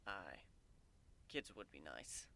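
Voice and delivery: low, soft, wistful admission. A narrator's reading. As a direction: let the longing show quietly.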